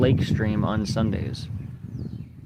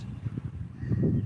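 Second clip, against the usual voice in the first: short airy laugh under the breath; a quick voiceless chuckle at the timing; breathy laugh; 7 dB quieter than usual. Real, not acted. Streamer amused by chat's perfect timing, breathy laugh.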